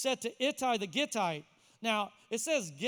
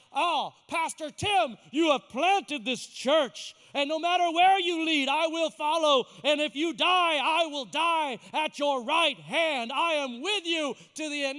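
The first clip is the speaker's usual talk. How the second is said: grand pledge of loyalty; 4 semitones higher, 8 dB louder than usual. Pretend. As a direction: declaim it like a hammed-up vow. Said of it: "We don't say, like".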